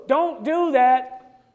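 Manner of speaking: slow, loud and drawn out; stern drawn-out command; slow emphatic warning. Retold or imitated. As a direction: lean on every word, very slowly. Repeating the warning slowly.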